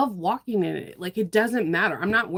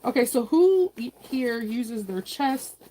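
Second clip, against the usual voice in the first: ordinary talk, nothing marked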